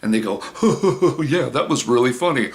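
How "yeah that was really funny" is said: high fake hooting laugh around a mocking remark; sarcastic smart ass. Smart ass answering a joke, sarcastic mock laughter. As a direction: imitate a smart ass faking amusement, pitched up. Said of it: "they're smart ass".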